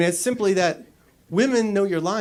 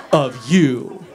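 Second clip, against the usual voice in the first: ordinary talk, nothing marked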